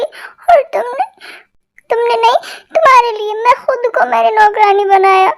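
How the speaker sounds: hammed-up crying to guilt him; a dramatic wife's put-on tears; mock crying. Wife complains tearfully to husband.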